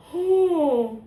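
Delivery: long high sigh of disbelief; loud sigh, breath pushed out high; heavy sigh. Real, not acted. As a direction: let out a big exasperated sigh. Overwhelmed, remembering the chaos.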